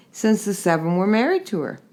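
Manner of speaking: plain reading voice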